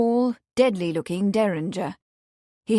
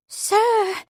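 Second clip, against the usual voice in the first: much higher startled cry; exclaiming; 13 semitones higher, 4 dB louder than usual. Acted. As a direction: blurt it out in alarm. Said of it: "she exclaimed".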